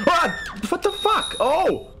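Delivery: high-pitched shocked outburst; jump-scare yelp, pitch shooting up; startled scream. Real, not acted. Startled by the game.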